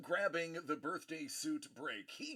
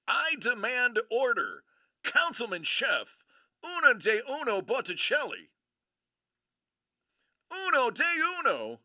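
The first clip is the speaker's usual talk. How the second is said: loud, high-pitched proclamation; excited hype; 7 semitones higher, 6 dB louder than usual. Real, not acted. Host proclaiming the Councilman's one of one, excited hype. Still hyping the rare card.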